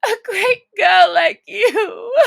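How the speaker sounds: high, loud, tearful scolding; Alice crying out at herself. Acted. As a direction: shout at herself through tears. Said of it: "said Alice".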